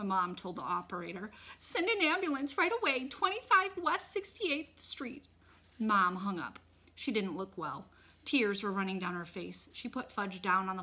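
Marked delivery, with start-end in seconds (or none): 1.6-5.3 high, urgent call for help; frantic mother on the phone; panicked demand. A narrator's reading. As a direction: give the details in a loud, frantic voice.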